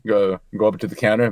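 ordinary talk, nothing marked

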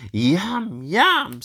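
lightly voiced eager puppy; a hint of a hungry puppy. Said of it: "said the little puppy".

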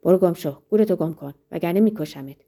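acted furious order to get out; threatening shout. A narrator's reading. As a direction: bark the threat in short, forceful bursts. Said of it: "با حالت تهدید بالای سرش ایستاد و فریاد کشید".

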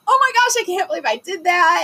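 retold shaky disbelief, high and loud; shaken disbelief. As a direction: gasp it out, rattled and amazed. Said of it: "like, shaking".

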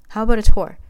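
cheerful hostess voice; bright offer. A narrator's reading. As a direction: perk up and move on.

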